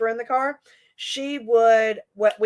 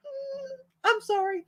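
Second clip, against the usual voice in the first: high, soft, fake-innocent apology; mock apology; 7 dB quieter than usual. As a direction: act sweetly sorry without meaning it. Mom's mock apology to kid.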